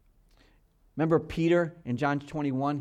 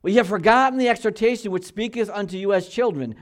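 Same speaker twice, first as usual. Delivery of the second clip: louder and higher, "ye have forgotten the exhortation" read at a steady pace; scripture reading at preaching pace; 6 semitones higher, 7 dB louder than usual. Shown as a demonstration. Pastor to his church, reading the verse straight through. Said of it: "now he's quoting back to Proverbs".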